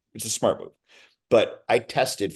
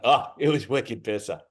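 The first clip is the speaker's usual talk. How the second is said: put-on thick Boston accent, slower; heavy Boston accent. Actor improvising his line for the network in heavy Boston. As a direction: lean into Boston as heavy as possible. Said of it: "as heavy as I could go".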